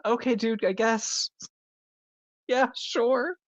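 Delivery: high-pitched, hesitant going along with it; mock doubtful agreement. Podcaster imitating a reaction to the actor's odd choice, doubtfully agreeing.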